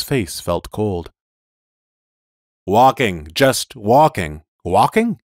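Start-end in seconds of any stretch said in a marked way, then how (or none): 2.7-5.2 robot voice. Acted. Machine repeats in disbelief.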